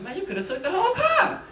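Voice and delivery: high, loud exasperated yell; mock-outraged at the thieves; indignant outcry. Comic telling crowd about thieves, loud mock outrage. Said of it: "I'm like".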